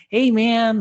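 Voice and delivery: high, loud laid-back "Hey, man"; imitating Duncan's stoner greeting; hippie stoner voice. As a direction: drawl it like a mellow stoner. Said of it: "very hippie, stoner sounding voice".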